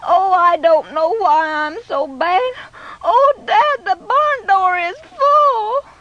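put-on sobbing boy. A narrator's reading. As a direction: wail it like a sorry little boy in tears. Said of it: "Jimmy burst into sobs".

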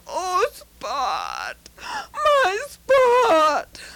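put-on boy crying over his burned dog; tearful boy. A narrator's reading. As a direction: sob out the dog's name. Pete finds burned dog.

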